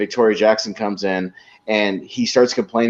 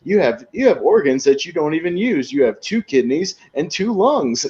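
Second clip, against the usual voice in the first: high, slowing salesman pitch; hammed-up pitchman; 6 semitones higher than usual. Pitchman selling organs to the viewers, high cheesy ad voice. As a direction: sell it like a cheesy TV spokesman. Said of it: "And he goes".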